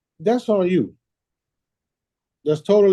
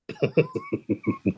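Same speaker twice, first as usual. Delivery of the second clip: very high, softer laugh; real laughter; 5 dB quieter than usual. Real, not acted. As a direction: laugh after your playful ultimatum. Laughing after own ultimatum.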